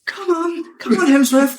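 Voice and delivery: teasing a friend by imitating her leading Hemsworth to set, high and sing-song; mock high-pitched coaxing. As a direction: coax him along like a pet, squeaky and high. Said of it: "I just have this vision of you being like".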